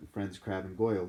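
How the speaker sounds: ordinary delivery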